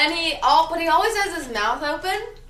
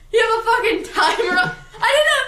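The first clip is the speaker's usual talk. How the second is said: laughing, higher and louder; 7 semitones higher, 5 dB louder than usual. Real, not acted. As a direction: laugh through the words. Laughing at Sam.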